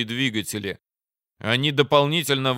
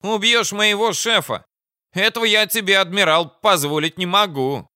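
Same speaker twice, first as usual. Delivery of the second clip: high, loud protest; indignant refusal; 9 semitones higher, 4 dB louder than usual. Acted. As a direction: fiercely forbid it in a raised voice. Commander refuses to betray chief.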